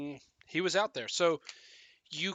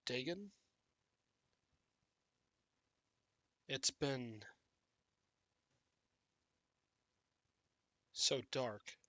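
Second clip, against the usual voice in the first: dazed, quiet zombie; 5 dB quieter, slower than usual. Acted. Dazed zombie speaks to Gabriel.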